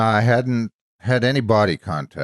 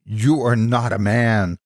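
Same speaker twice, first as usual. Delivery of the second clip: slow, higher narrated shout; 4 semitones higher, 4 dB louder, slower than usual. A narrator's reading. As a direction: throw the words at him in anger. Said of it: "shouted at me".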